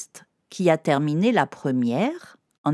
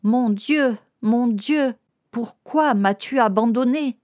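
appel plus haut et plus fort, implorant; cri; 6 semitones higher than usual. Acted. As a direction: crie vers Dieu, abandonné. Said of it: "Jésus crie". (Jésus crie vers Dieu, plus haut et plus fort, implorant)

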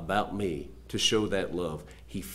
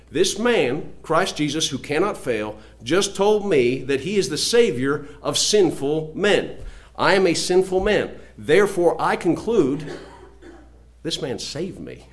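loud, higher-pitched preaching voice; emphatic preaching; 6 semitones higher, 6 dB louder than usual. Real, not acted. Preacher declaring his assurance of salvation to the congregation, emphatic preaching. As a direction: drive each phrase home forcefully from the pulpit.

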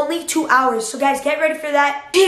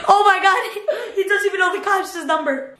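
ordinary talk, nothing marked

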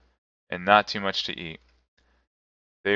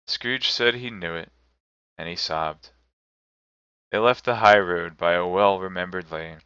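usual speaking voice

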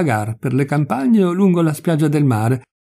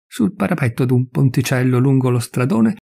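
plain reading voice

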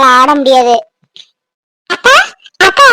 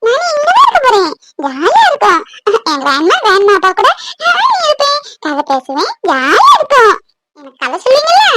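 put-on child's high, eager voice; excited grandchild; 6 semitones higher than usual. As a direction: chirp happily to grandma in long singsong phrases. Grandchild arriving, chattering to grandma.

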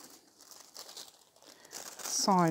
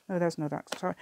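ordinary talk, nothing marked

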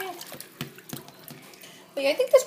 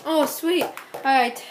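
usual speaking voice

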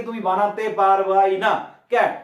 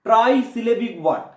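usual speaking voice

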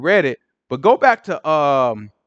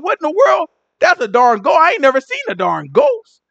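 high, startled cry of disbelief; spooked fisherman seeing a ghost; 9 semitones higher than usual. Retold or imitated. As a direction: gasp it out like you just saw a ghost.

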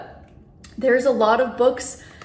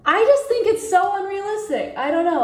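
exasperated; 10 semitones higher than usual. Real, not acted. Exasperated about book plot.

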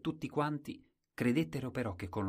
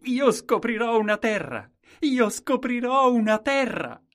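shout; 14 dB louder than usual. Acted. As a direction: hurl it across the fields. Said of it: "ai contadini ... gridò".

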